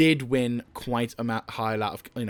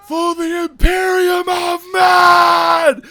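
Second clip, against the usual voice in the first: much higher, louder, slow drawn-out war shout; battle cry shout; 11 dB louder, much slower than usual. Retold or imitated. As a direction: bellow it like a trooper charging in. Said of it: "occasionally shout".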